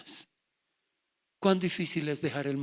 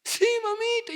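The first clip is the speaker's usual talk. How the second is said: much higher and louder, a fake childish yes; mock sweet whine; 8 dB louder than usual. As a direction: sell the lie with a high wheedling voice. Son fakes agreement to mother.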